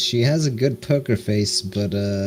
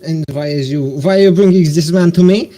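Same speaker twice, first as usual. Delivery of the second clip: indignant lord; 6 semitones higher, 4 dB louder than usual. Acted. Lord angered by the prisoner.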